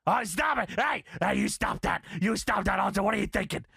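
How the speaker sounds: hammed-up commanding shouts; mock shouted protest turning into egging on; Vince. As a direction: imitate an outraged boss yelling into the mic. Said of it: "Vince is heard on the mic".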